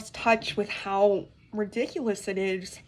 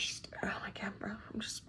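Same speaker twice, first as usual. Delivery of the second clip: exasperated whisper; 15 dB quieter than usual. Real, not acted. Vlogger whispering to viewers, too annoyed to speak. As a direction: drop to a breathy whisper of disbelief.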